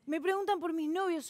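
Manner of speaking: normal talking voice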